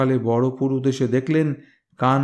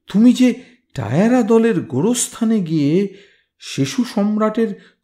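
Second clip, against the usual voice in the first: much higher, louder shocked reproach; alarmed priest scolding; 9 semitones higher, 4 dB louder than usual. Acted. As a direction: scold him in horror at what he did. (ভয়ার্ত পুরুতের তিরস্কার)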